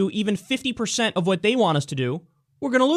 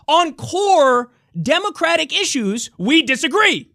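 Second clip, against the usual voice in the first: mocking; 9 semitones higher, 4 dB louder, slower than usual. Mocking party line.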